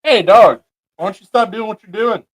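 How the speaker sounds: loud, high call across the room; teasing friends across the room, loud and high. Real, not acted. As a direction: shout it over to a friend. Calling out to people passing.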